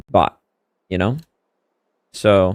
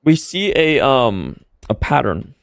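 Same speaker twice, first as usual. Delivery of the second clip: speaking through hiccups; hiccuping; 5 semitones higher, 3 dB louder, slower than usual. Real, not acted. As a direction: hiccups breaking the words. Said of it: "Oh my God, I'm hiccuping".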